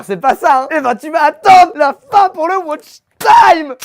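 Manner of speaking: high, slow mocking yell; teasing shout. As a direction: yell it at the viewers.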